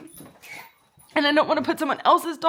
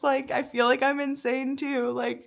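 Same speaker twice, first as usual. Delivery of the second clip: ordinary talk, nothing marked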